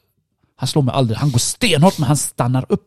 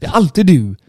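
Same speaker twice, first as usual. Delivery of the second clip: raised, accusing repeat; higher-pitched mock fury; retold anger; 6 semitones higher, 4 dB louder than usual. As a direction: act out an exasperated accusation. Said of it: "därför blir jag extra arg".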